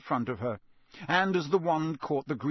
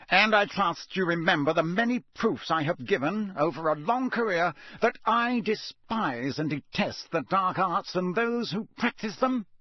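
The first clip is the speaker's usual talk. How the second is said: shouted; 6 semitones higher, 3 dB louder than usual. A narrator's reading. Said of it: "Mister Crouch shouted".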